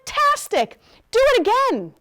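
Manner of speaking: high, loud cheer; excited shout. A narrator's reading. Princess goads dragon to fly again, shouting. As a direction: shout it with mock delight, egging them on. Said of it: "Elizabeth shouted".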